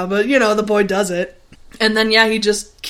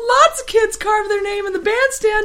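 put-on whiny kid voice; 13 semitones higher, 5 dB louder than usual. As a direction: whine like a caught kid. Boy voiced pleading.

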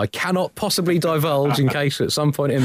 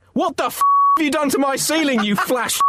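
furious; 14 semitones higher than usual. Acted. Angry Pope storms in.

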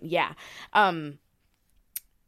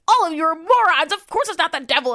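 much louder and higher, fast scolding; angry letter-writer shout; 9 semitones higher, 8 dB louder than usual. Acted. Host voicing an 1855 letter to the editor, shouting. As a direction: yell at everyone like a furious sceptic.

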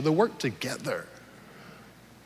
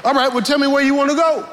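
higher and fast, fed-up husband; exasperated husband; 7 semitones higher, faster than usual. Retold or imitated. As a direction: push her to just pick a place. Exasperated husband in retold argument.

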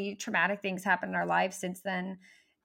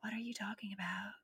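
hushed, whispered disbelief; baffled whisper; 11 dB quieter than usual. Retold or imitated. Americans puzzled by her British baby words, baffled whisper.

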